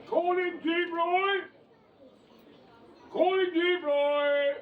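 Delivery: high, slow, loud call across the stage; slow, high stage manager's shout. Retold or imitated. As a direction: summon him loudly across the set. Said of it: "you heard the stage manager say".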